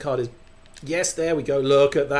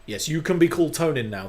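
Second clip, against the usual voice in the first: normal talking voice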